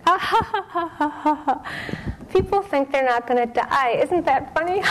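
high, loud roaring laugh, then the words still laughing and amused; imitating roaring laughter. Retelling how Sayadaw laughed at the cancer news, roaring with laughter. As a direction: mimic the teacher cracking up at death. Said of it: "just roaring with laughter".

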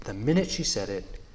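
ordinary delivery, nothing marked

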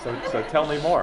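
laughing, high and loud. Real, not acted. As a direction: say it through a laugh. Amused prompt to teacher.

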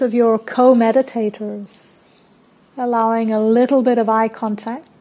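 calm meditation voice with long pauses; unhurried, settled guidance, softening at phrase ends; guided meditation. Real, not acted. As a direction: guide them gently inward, phrase by phrase.

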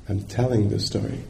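usual speaking voice

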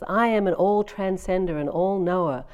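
a touch of a proud proclamation; bold pronouncement. Retold or imitated. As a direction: announce it like a declaration to the world. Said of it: "makes his bold pronouncement".

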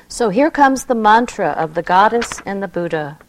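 usual speaking voice

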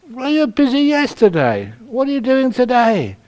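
high, insistent questioning voice; pushy caller. Retold or imitated. Caller pressing the nun for a visit, high and insistent. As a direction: press her like an impatient caller.

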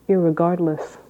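ordinary talk, nothing marked

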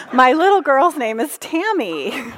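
can't hold back the giggle; laughing. Real, not acted. Teacher delivering the joke's punchline to the class, laughing.

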